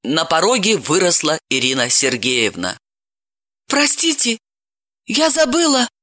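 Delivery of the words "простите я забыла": high, timid woman's voice; timid apology. A narrator's reading. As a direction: Irina bursting in, timidly apologizing. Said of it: "начала она робко".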